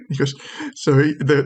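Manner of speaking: ordinary delivery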